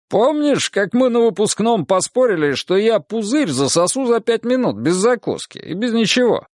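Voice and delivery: high-pitched, boisterous reminiscing; carefree Vitka. A narrator's reading. Vitka recalls graduation drinking bet, carefree and lively. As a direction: tell a drinking tale with relish.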